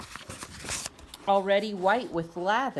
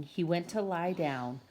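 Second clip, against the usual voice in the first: plain reading voice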